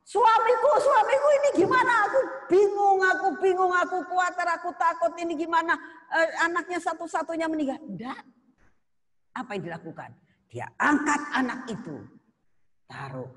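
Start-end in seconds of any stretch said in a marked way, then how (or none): 0.0-7.8 high, loud panicky wailing to husband; hysterical wife. Pretend. Preacher mocks how a panicked mother would wail to her husband, high and frantic. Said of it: "dia nggak teriak-teriak".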